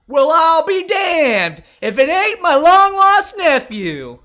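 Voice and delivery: big, loud, slow hearty greeting; warm booming welcome. A narrator's reading. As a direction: boom it out like a delighted uncle at the door. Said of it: "Uncle Joe welcomed me warmly".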